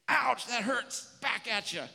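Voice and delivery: high, loud, slow hammed-up ouch; acted ouch then a snippy comeback; mock hurt retaliation. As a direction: play the offended kid hitting back.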